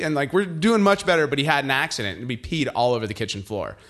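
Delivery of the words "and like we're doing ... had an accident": usual speaking voice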